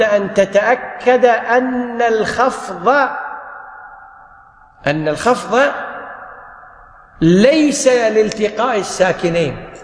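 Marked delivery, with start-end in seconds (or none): none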